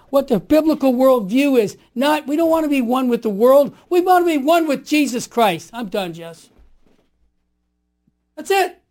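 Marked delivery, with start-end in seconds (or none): none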